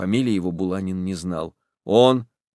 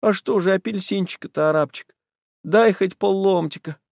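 pleading voice; 10 semitones higher than usual. Acted. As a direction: beg meekly for a slice. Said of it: "опять слышится молящий голос".

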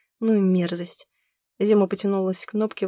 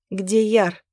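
louder, angry demand; 5 dB louder than usual. A narrator's reading. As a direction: snap the question out angrily. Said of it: "злобно спросила она".

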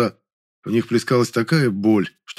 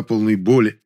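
usual speaking voice

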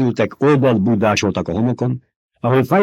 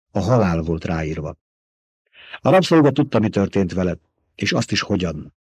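ordinary delivery, nothing marked

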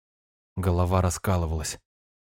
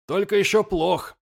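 high raised call; 10 semitones higher than usual. Acted. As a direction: shout it across the deck. Lirak still calling out.

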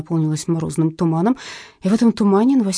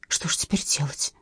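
frightened, hushed whisper; alarmed whisper; 4 semitones lower, 7 dB quieter than usual. A narrator's reading. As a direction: whisper it in a panic. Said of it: "всполошилась Глафира Сергеевна".

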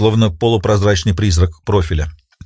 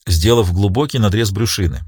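usual speaking voice

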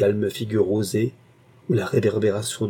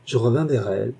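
ordinary delivery, nothing marked

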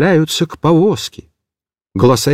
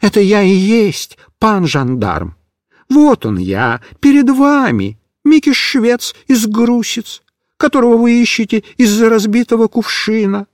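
mild scared explaining; 6 semitones higher than usual. A narrator's reading. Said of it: "испуганно объяснял Микиш".

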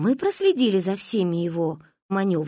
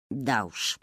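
wry hmph; 8 semitones lower, 7 dB quieter than usual. Acted. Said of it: "хмыкнул папа".

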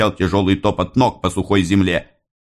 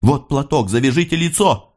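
Sofia's urgent orders. A narrator's reading. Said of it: "быстро говорила Софья".

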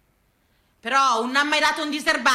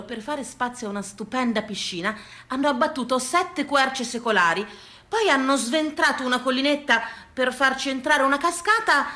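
ordinary talk

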